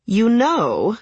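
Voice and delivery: high, whining mother-in-law; annoying whiny voice. A narrator's reading. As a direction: whine it out to make a point. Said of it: "in that annoying whiny voice".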